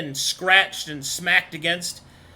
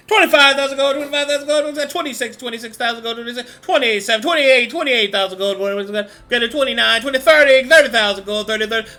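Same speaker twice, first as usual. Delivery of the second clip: louder, higher auction calling; auctioneer; 5 semitones higher, 7 dB louder than usual. Acted. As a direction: rattle off the bids like an auctioneer. GM voices auctioneer calling bids.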